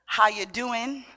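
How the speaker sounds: ordinary talk, nothing marked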